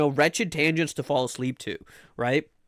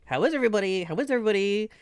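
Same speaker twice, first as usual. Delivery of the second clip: sing-song question; 6 semitones higher, faster than usual. Acted. Greeting repeated, higher.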